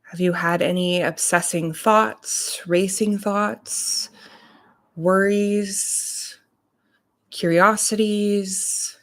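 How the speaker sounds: unhurried meditation voice, long pauses between phrases; guided meditation. Real, not acted. Reverend leading morning listeners into breath and body awareness, guided meditation. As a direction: guide them calmly, leaving space to breathe.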